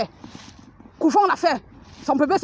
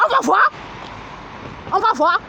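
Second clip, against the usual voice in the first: defiant outburst; 5 semitones higher than usual. Real, not acted. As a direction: throw "On va voir" out as a threat. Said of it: "repeated defiant line".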